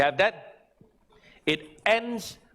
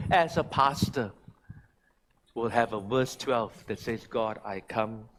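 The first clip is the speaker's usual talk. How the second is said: softer, earnest closing prayer; soft prayerful close; 6 semitones lower, 4 dB quieter than usual. Acted. Pastor prays over his congregation, soft earnest prayer. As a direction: pray it softly over them.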